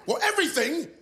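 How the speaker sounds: high, giddy holiday excitement; excited high voice. Acted. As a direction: sell it like a party. Miming drinking, excited.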